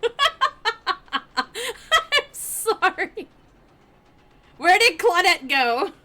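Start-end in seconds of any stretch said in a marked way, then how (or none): none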